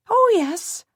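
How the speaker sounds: put-on high, quick voice of a flustered peasant girl; a startled servant girl piping up to a lord.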